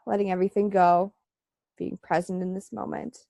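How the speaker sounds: calm guided meditation voice. Acted. Leads breathing meditation.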